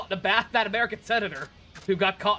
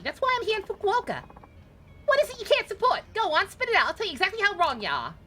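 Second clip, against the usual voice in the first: put-on high New York accent, brash; a brassy New York lady warning him; 10 semitones higher than usual. Passenger warns the driver, high New York accent. Voices female taxi passenger.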